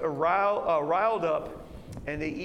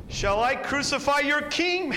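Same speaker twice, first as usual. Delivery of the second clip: loud, high appeal to the crowd; loud desperate question to the mob; Pilate pleading loudly; 10 semitones higher, 7 dB louder than usual. Acted. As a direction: call out over a riot, pressing on king. One more chance.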